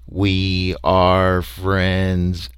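very slow monotone alien chant; robotic, drawn-out alien drone; creature's droning greeting. A narrator's reading. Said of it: "their greeting was".

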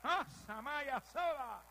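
loud, high, drawn-out outburst; slow ecstatic cry in tongues. Real, not acted. Preacher overcome while telling of the Spirit, crying out in tongues.

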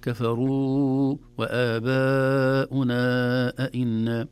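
وآباؤنا with long stretched vowels; slow recited كفروا وآباؤنا أئنا; careful madd. Shown as a demonstration. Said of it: "هذه تحتاج إلى عناية".

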